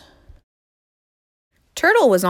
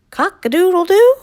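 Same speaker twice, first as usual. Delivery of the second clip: high, loud crow rising at the end; questioning crow; 11 semitones higher, 7 dB louder than usual. Acted. Horse tries the crow again, questioning.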